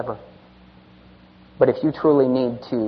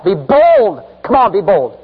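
louder, higher push to be bold; urging call; 6 semitones higher, 6 dB louder, slower than usual. Real, not acted. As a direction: rouse them to stand.

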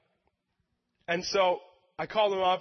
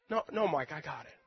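soft, quiet reassurance; God calmly telling Mike he will handle it; gentle refusal; 5 semitones lower, 6 dB quieter than usual. Retold or imitated. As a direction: let it fall to a hush. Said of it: "God answering softly".

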